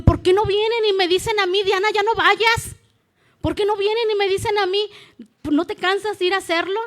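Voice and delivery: más agudo y fuerte, reclamando; reclámales con indignación; reproche indignado. Retold or imitated. Reproche a quienes desaniman. (reproche indignado y agudo)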